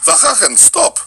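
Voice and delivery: put-on exasperated Armenian auntie accent; scolding in a thick Armenian accent. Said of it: "he couldn't do the Armenian accent".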